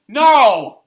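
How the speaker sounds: a high, loud retold shout of no. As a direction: bark the no at them. Cop answering in story.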